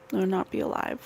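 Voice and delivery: ordinary delivery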